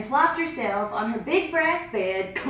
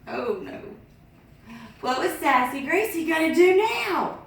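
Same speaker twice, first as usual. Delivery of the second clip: ordinary delivery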